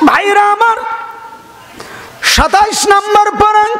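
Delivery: high, held announcing cry; calling out. Real, not acted. Preacher announces the Quran passage to his brothers, calling out. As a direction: announce it high to the whole gathering.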